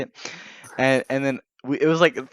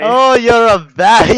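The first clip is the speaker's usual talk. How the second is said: loud, high, slow hammed-up scolding; mock scammer scolding; 9 semitones higher, 11 dB louder, slower than usual. Imitating scammer scolding them.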